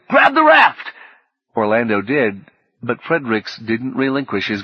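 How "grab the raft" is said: much higher, louder yell; frantic shouted order. A narrator's reading. Fredericks repeating his order to Orlando, shouting. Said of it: "Fredericks directed".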